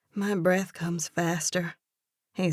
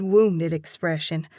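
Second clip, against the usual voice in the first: ordinary delivery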